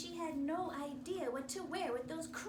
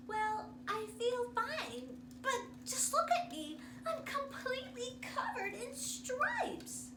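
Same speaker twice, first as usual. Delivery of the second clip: put-on little girl; 10 semitones higher than usual. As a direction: whine it like a worried girl. Girl voiced, upset.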